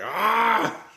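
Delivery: high, loud hammed-up yell; mock battle yell. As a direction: roar as she charges. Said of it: "She's like".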